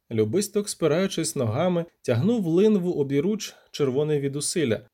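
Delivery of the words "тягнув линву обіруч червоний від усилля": usual speaking voice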